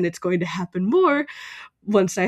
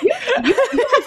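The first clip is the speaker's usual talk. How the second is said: laughing; 5 dB louder, much faster than usual. Real, not acted. Laughing excited reply.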